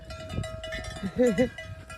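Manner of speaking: breathy little laugh; quiet unvoiced chuckle; soft airy laugh between calls. Real, not acted. Laughs while calling cows.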